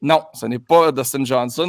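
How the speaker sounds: ordinary talk, nothing marked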